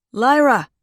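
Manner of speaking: loud high shout through a door; calling out. A narrator's reading. Prince calling Lyra through the door, loud shout. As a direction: call her name hard through the wood. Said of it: "he called out".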